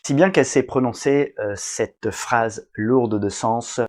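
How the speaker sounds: ordinary delivery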